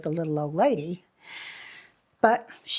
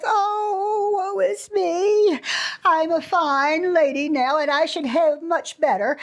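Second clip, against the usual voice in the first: high, slow whiny lament, easing as she complains; lamenting old lady; 8 semitones higher, slower than usual. A narrator's reading. Old lady laments to the fairy, high and whiny. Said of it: "again crying and lamenting and saying".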